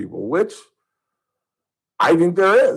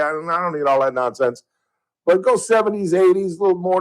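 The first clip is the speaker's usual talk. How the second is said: usual speaking voice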